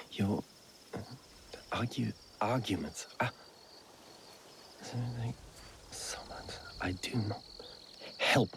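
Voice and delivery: reading under the breath, barely audible; quiet mumbled reading. Acted. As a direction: mumble the letter softly to yourself. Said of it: "I meant read it out loud".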